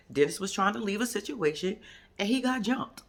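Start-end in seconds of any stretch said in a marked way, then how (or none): none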